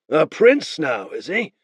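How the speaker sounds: slow, lightly scoffing; mocking Beren. Beren scoffs at the boy's claim, mild mockery. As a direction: raise a brow in your voice.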